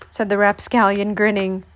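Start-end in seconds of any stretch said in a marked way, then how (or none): none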